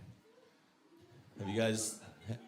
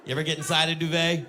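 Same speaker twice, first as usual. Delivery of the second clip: ordinary talk, nothing marked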